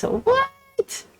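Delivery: high squeal of disbelief; shocked squeal. Real, not acted. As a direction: gasp out a stunned what. Shocked at China news.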